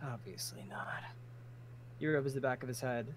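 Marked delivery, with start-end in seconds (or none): 0.0-1.0 soft unvoiced aside; whispered aside. Crimson's wry aside to himself, whispered. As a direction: whisper it under your breath.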